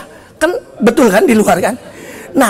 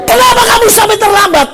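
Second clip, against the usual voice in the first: high, raised scolding question; angry interrogation; 11 semitones higher, 4 dB louder than usual. Acted. Teacher asking why student is late, angrily. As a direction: demand to know, voice pitched high.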